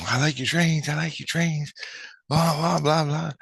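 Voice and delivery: high-pitched imitation of a kid carrying on; excited little kid. Kid praising the trains, imitated high chatter. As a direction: chatter in a high mock-child voice. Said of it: "he's carrying on like this".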